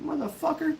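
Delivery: high-pitched retold curse; mock dismay in a high voice; startled mock cursing. Recalling his reaction to charging geese, high mock dismay. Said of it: "You're like".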